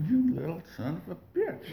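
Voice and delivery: high, soft mock cursing; retold cursing. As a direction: replay the curse small and high. Repeats his curse from the car.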